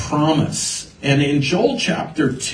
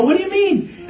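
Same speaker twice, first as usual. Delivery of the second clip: put-on puzzled teen. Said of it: "I go".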